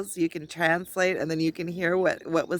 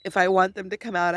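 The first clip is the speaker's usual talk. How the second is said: normal talking voice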